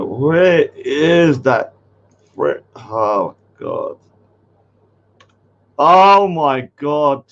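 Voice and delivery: ordinary delivery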